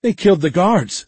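high, alarmed cry from a crowd; shouting. A narrator's reading. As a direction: shout the accusation, pitched high. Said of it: "someone shouted".

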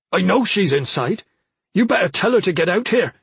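high, heated accusation; infuriated. A narrator's reading. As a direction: order her about, temper rising. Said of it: "Suddenly Tavo was infuriated".

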